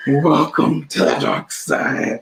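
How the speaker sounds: slow, drawn-out mock villain voice; Kylo Ren. Host repeats the villain line when asked "How?", slow mock-ominous. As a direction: stretch out every word like a hammed-up dark lord. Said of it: "that's how Kylo Ren moves".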